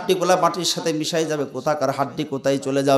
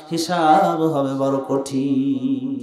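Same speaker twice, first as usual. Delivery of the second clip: slow grave emphasis; much slower than usual. Acted. Contrasting hard judgment, drawn out.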